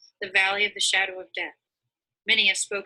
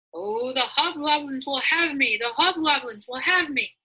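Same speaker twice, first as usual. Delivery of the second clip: terrified cry; 6 semitones higher than usual. Retold or imitated. Greatheart imitates Mister Fearing's terrified cry in the valley. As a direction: wail it as if the hobgoblins are coming. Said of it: "he cried".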